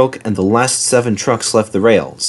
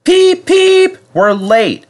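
very high whistle peeps, then fretful complaint; high, loud anxious engine; fussing; 5 dB louder, much slower than usual. A narrator's reading. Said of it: "fussed Edward".

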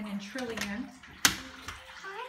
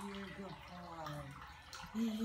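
soft slow coo; 4 semitones lower, 6 dB quieter, much slower than usual. Real, not acted. Cooing to a cat.

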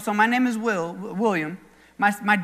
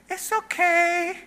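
put-on high, drawn-out child's voice, soothing; comforting little girl; 7 semitones higher, much slower than usual. Little girl rubbing crying classmate's back, mock comforting. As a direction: imitate a five-year-old slowly consoling a friend. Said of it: "that was comforting".

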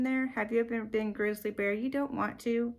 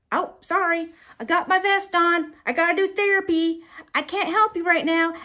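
high, loud mock apology; son excusing himself; 9 semitones higher, 10 dB louder than usual. Grown son telling his kids he has therapy, loud excuse. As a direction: call out the excuse brightly over the clamor. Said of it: "You're gonna be like".

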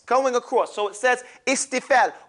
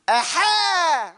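shouted aha; 7 semitones higher than usual. Shown as a demonstration. Teacher leads the class in a shouted aha. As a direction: call the aha out big. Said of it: "Come on, Ya Abu Muhammad".

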